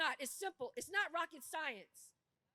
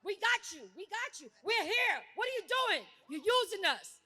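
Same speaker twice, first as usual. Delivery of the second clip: louder, rising, fast fired-up crowd rally; rousing rally preaching; 4 semitones higher, 8 dB louder than usual. Real, not acted. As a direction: whip up the crowd to act. Rally speech at full force.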